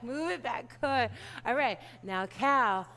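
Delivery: ordinary talk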